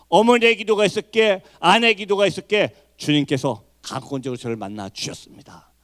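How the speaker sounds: normal talking voice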